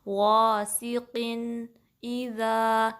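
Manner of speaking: غاسقٍ اذا very slow, clear n then separate i; loud heavy start of غاسقٍ, distinct n before اذا; clear izhar. Shown as a demonstration. Teacher demonstrating izhar, slow clear غاسقٍ اذا. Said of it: "ظاہر کر کے پڑھیں گے".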